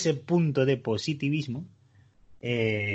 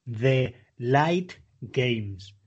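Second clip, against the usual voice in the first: "The Light Games" drawn out very slowly, every syllable stretched; mock her slow careful "The Light Games"; over enunciating; much slower than usual. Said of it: "Porque tú dices".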